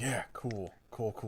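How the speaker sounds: soft offhand cool repeated; mock cool. Co-host echoing the movie's catchphrase, soft mocking. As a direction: mumble it like it means nothing. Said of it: "he's like".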